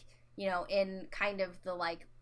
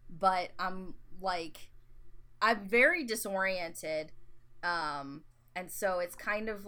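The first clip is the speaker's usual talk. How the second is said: usual speaking voice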